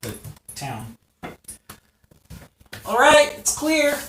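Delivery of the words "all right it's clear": high, loud all-clear call; Lanny calling out. Acted. Lanny calls the hidden heroes out from the town center, loud and high. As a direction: shout it across the town, high. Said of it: "calling from the center of".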